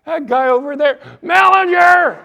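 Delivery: put-on high, loud kid's shout; little boy shouting out who sent him; child tattling. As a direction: point and blurt the name. Boy pointing out the narrator.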